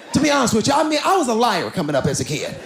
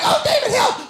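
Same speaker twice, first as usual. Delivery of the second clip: mock panicked cry. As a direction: squeal like you're being dragged away. Wife dragged to nursing home.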